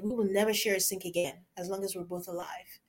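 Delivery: hushed unvoiced whisper. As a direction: whisper it like a vow. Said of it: "I told my husband".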